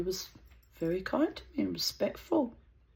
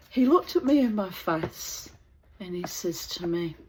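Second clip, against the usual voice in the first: ordinary talk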